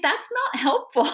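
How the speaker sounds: high mock-exasperated retort. Grieving hiker scoffing at friend's advice, mock exasperation. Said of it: "And I'm like".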